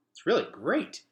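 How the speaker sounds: put-on bright, lifted boyish praise; enthusiastic boy. A narrator's reading. Gregor admiring the city, lifted and eager. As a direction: let the wonder spill out high. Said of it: "he said".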